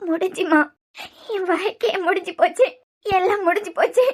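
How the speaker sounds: soft, broken crying voice; tearful despair. Acted. Daughter telling mother her life is over, tearful despair. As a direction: lament it quietly through tears. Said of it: "இப்படி அழுதுகிட்டு இருக்க".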